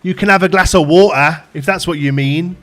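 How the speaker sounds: loud, pointed parental correction; dad correcting his son's word for water, loud and pointed; correcting his kid. Retold or imitated. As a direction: put the kid right, firmly. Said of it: "Dad, can I have some water?".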